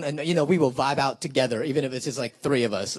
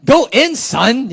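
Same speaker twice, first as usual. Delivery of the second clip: higher, louder hype shout; yelled cheer; 8 semitones higher, 6 dB louder, much slower than usual. Shown as a demonstration. Showing the audience another cheer, loud hype yell. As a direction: holler it at the poet on stage. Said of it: "or you could yell".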